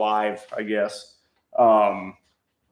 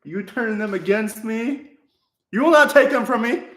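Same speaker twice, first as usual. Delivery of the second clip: dramatic villain; 9 semitones higher, 3 dB louder than usual. Pretend. Mock Star Wars villain lines.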